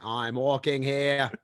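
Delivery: put-on slow, drawn-out Boston-style accent; mock Boston accent. Host repeating a line on his co-host's request, hammed-up Boston accent. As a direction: stretch the vowels in a bad Boston accent. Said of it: "Can you say that voice and say".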